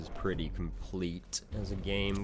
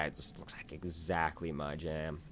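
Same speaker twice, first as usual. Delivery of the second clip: normal talking voice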